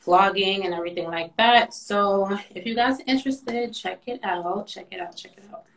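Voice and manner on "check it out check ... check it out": each repeat softer, fading away. Real, not acted. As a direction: let the words fade out. Repetition fading out.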